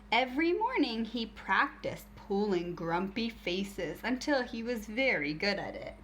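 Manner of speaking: ordinary talk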